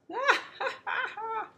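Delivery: high, loud bird cackle; kookaburra laugh. Acted. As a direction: laugh off the silly idea.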